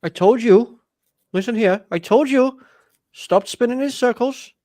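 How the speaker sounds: attempted Welsh accent, rugby-style ribbing. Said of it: "trying to do that, uh, rugby Welsh accent thing".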